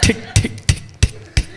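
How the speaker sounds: unvoiced ticking of a dead ignition; clicking starter that won't catch; dead car starter impression. Shown as a demonstration. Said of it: "Here's my impression of my car trying to start".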